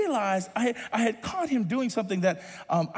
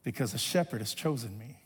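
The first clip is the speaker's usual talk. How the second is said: quiet quote; 8 semitones lower, 6 dB quieter than usual. Acted. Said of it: "says the psalmist".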